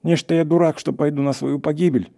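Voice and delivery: high plaintive refusal; acted reluctant wail; moaning protest. A narrator's reading. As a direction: whine your way out of going. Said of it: "простонал лесник".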